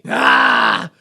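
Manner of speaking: loud, low "ah" burst; loud mock cry. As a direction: blurt a big comic "ah". Mock triumphant cry in a riff.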